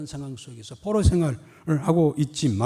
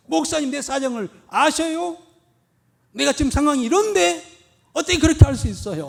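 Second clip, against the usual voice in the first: higher, louder complaining retort; talking back; 9 semitones higher, 8 dB louder than usual. Retold or imitated. Church member talking back to the pastor, indignant. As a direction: push back hard at the pastor. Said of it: "대드는 분들이 있어요".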